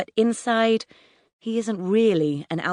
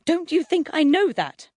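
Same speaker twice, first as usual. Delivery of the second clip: much higher, frantic retort; hysterical cry; 11 semitones higher, 3 dB louder than usual. A narrator's reading. Mum at her panicking son, hysterical. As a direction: cry it out at the end of your tether. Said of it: "Mum cries hysterically".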